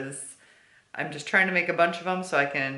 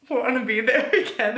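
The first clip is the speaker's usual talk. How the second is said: wistful laughing; 6 semitones higher, 8 dB louder than usual. Real, not acted. Wistful about the tour.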